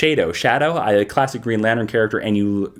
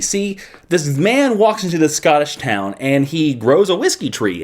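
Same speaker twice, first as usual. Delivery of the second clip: ordinary delivery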